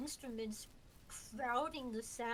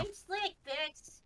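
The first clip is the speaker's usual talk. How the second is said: smiling; 4 semitones higher, 5 dB louder, slower than usual. Real, not acted. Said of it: "You could hear the smile in my voice".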